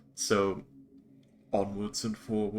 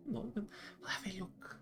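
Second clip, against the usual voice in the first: whisper; 13 semitones higher, 8 dB quieter than usual. Real, not acted. Drops to a whisper.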